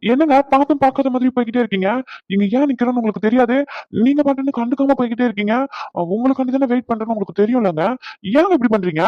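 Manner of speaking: rapid complaint. Acted. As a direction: rattle it off, aggrieved.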